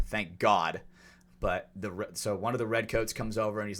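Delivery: ordinary delivery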